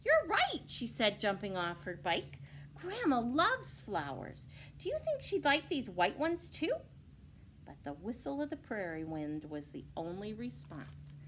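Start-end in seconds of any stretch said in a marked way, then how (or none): 0.0-0.6 put-on very high excited girl; a delighted girl agreeing. 2.7-6.7 put-on a girl picking flowers; eager girl.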